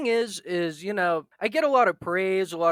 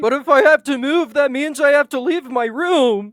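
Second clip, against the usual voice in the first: whiny; 7 semitones higher, 8 dB louder than usual. Acted. Joking whine about leaving room.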